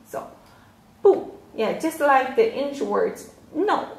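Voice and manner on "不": 不 said as a sharp, loud falling tone; 不 cut short with a strong fall. Shown as a demonstration. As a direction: drop hard on 不, short and strong. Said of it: "不 is a falling tone".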